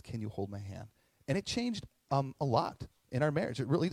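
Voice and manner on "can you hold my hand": much softer, gentle and hushed; tender request. Retold or imitated. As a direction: ask it softly like the wife did. Wife's tender request quoted.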